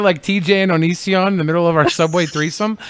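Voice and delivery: normal talking voice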